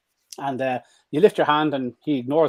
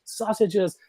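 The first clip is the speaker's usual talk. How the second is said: excited dog; 11 semitones higher, much slower than usual. Retold or imitated. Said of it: "He's like".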